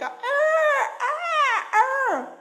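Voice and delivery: high, piercing mock honking ah; imitated goose cries. Speaker imitates geese calling back to their friend, high cries. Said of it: "They are crying like".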